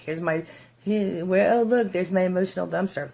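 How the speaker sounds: exhausted narcissist mocking the partner at home; mock disgusted narcissist. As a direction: sneer at your partner as a dumpster. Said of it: "they're like".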